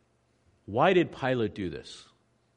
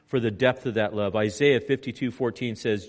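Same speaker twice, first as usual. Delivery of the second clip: ordinary delivery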